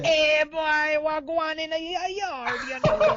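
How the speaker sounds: high, put-on Jamaican accent. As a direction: ham up a Jamaican voice. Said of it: "your Jamaican accent".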